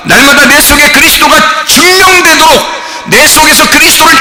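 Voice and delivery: high, loud ringing sermon cry; full-force preaching. Acted. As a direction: preach it out at the top of your voice.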